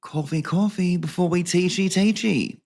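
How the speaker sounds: high, put-on catchphrase voice. Vlogger reading out the coffee mug, high Mr G voice. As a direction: pitch it up like a comic drama teacher. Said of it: "it's Mister G from Summer Heights High".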